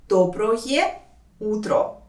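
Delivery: «Доброе утро» with full final O sounds; slow, careful enunciation. Shown as a demonstration. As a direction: draw out «Доброе», pause, then «утро». Teacher shows endings slowly.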